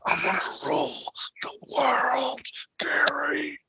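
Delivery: high breathy whisper, slow and creepy; Sid impression. Sid tells Gary at lunch, high eerie whisper. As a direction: hiss out a grand threat to Gary. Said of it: "Sid's like".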